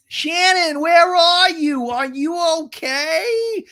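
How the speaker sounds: high, loud, slow calling; mock calling out. Gina calling into the woods for Shannon, high and drawn out. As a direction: call into the dark woods.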